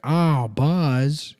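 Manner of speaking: mock a friend's failed Tom Hanks; bad Tom Hanks. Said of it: "He was doing Tom".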